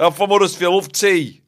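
high, loud Huddersfield local; Huddersfield accent. Acted. Making friends laugh as a Huddersfield local, broad Huddersfield accent. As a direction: send up broad Huddersfield speech.

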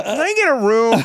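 high, loud tearful plea, hammed up; mock crying. Crying guest asking the desk for a room, high mock wail. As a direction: beg through pretend sobs. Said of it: "And I started crying".

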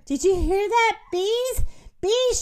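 high, alarmed calling; shouted. A narrator's reading. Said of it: "shouted the turkeys".

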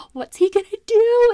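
high, loud, gushing guest voice; eager wedding guests. Retold or imitated. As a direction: squeal it like a nosy wedding guest. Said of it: "everyone's like".

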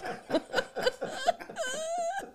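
long high-pitched, quiet laughing fit; helpless laughing. Real, not acted. Cracking up over the script she reads, helpless high laughter. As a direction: collapse into squealing, tearful laughter.

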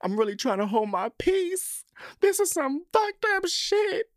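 very high, hammed-up tearful squeal; crying lady. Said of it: "and she's crying. She's like".

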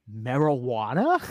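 slow, drawn-out puzzled question; lightly imitating a baffled Zach, rising at the end; mock puzzled Zach. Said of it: "Zach looks at her and goes".

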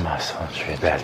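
low, soft Batman murmur; sultry Batman voice. Acted. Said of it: "his voice kinda changes in a good way to Batman".

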